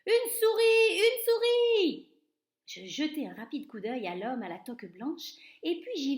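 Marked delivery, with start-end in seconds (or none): 0.1-0.9 high, loud shriek of alarm; panicked shout. A narrator's reading. Cook spotting a mouse in his kitchen, high loud shriek. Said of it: "J'entendis un homme s'écrier". 0.9-2.1 high, loud shriek stretched out slowly; drawn-out panicked shout. A narrator's reading. Cook still spotting the mouse, slow loud shriek. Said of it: "J'entendis un homme s'écrier".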